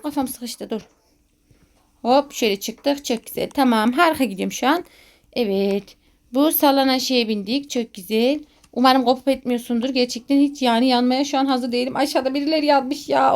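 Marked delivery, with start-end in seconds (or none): none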